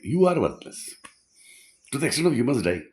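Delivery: hammed-up contemptuous yell; mock shouted put-down. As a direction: bark it like a harsh guru.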